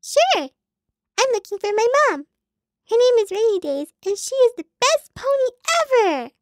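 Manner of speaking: high, loud little pony chirp; cutesy pony voice. Acted. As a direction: play the sweet little pony asking for mom.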